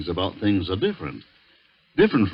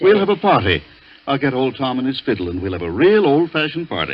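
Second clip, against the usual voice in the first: higher, louder hearty enthusiasm; forced cheer; 6 semitones higher, 7 dB louder than usual. Acted. Harvey changing the subject with forced party cheer, higher and louder. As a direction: paper over the tension with loud jollity.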